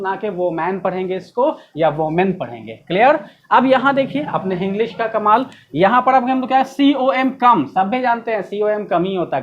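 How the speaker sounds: usual speaking voice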